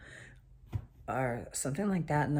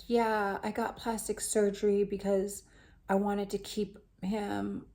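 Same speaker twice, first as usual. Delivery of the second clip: a slightly higher, drawn-out confession; lightly voiced guest star; 6 semitones higher than usual. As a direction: lightly imitate a woman explaining herself. Voicing guest star's confession.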